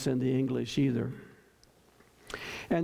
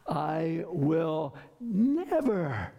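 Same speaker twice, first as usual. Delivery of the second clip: slow, weighty build onto a drawn-out NEVER; emphatic NEVER; much slower than usual. Shown as a demonstration. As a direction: stretch NEVER out like a preacher's vow. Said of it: "emphatically, fist on the pulpit".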